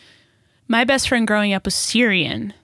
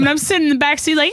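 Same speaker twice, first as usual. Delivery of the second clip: normal talking voice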